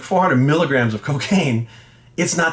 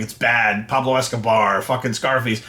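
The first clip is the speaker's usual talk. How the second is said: lower, dumb know-it-all voice; mocking the ignorant; 4 semitones lower than usual. To the critics: lower, mocking know-it-all drone. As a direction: parrot them mockingly, lower and dopey.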